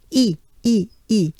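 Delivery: "E" as short repeated ee, lips spread; three separate smiling "E" sounds, pronounced ee; banana-smile E. Shown as a demonstration. Teacher drilling the banana smile, short ee repeats. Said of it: "here upcoming is the banana smile".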